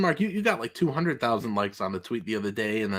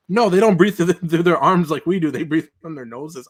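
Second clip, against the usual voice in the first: loud, higher retort; alien correcting another; 4 semitones higher, 7 dB louder than usual. Retold or imitated. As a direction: put the other alien straight, loudly. Acting out the book's aliens.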